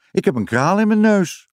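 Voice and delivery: put-on high-pitched alarmed child's cry; calling out. A narrator's reading. Jip with a bead up his nose, calling out. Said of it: "roept hij".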